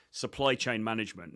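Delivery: ordinary delivery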